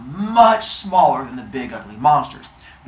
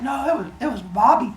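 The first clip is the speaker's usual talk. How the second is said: put-on guilty kids; 9 semitones higher than usual. Kids blaming brother.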